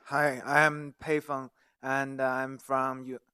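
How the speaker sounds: ordinary delivery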